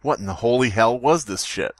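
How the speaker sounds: normal talking voice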